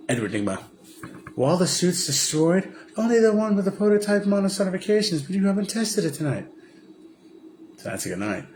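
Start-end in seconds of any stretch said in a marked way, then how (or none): 1.3-6.5 pitched up, lightly voiced figure; a hint of a higher voice; put-on character voice. Retelling a suit scene in a light high voice.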